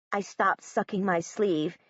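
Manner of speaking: usual speaking voice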